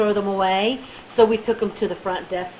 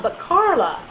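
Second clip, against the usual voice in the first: ordinary delivery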